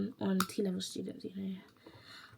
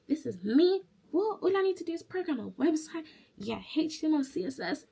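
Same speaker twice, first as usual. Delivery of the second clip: high, lively mock bragging; cocky delight; 7 semitones higher than usual. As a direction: act out breezy confidence, pitched up. Acts out her confidence in exam.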